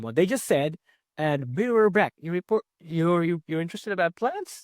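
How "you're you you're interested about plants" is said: gentle echo ending up on "plants?"; questioning tone. Shown as a demonstration. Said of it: "With a questioning tone".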